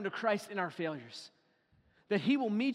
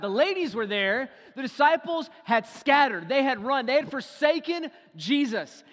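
louder, driving sermon build; forceful preaching; 5 semitones higher, 9 dB louder than usual. Acted. Preacher to congregation, rising loud and forceful. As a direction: hammer home the disciples' failure.

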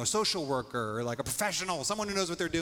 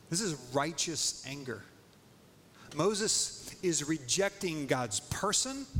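normal talking voice

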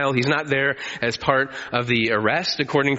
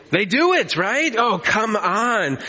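exasperated parent; 6 semitones higher, slower than usual. Acted. Exasperated parent at disobedient child.

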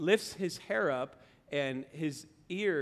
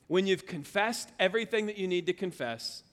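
normal talking voice

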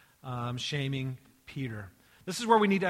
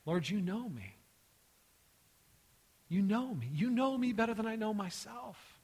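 raised pleading that drops to soft confession; heartfelt plea; 3 semitones higher, 4 dB quieter than usual. Acted. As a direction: plead to be believed, then fade to near hush. Acting out Peter's grieved plea.

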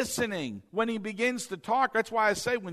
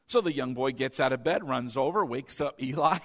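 usual speaking voice

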